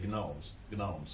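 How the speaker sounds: "gnomes" with a hard G before the N; Greek pronunciation. Shown as a demonstration. Teacher showing how Greek would say it, G sounded in "gnomes". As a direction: say "gnomes" as guh-nomes, both times. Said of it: "but in Greek, you'd say".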